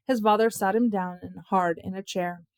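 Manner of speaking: usual speaking voice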